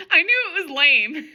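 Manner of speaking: high, loud, laugh-choked reading; cracking up mid-sentence; laughing while reading. Real, not acted. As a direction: push the words out through real laughter. Cracking up at own story line.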